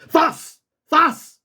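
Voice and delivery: high, sharp order to bite; forceful high-pitched command; attack command. Shown as a demonstration. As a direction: set the dog on a runner.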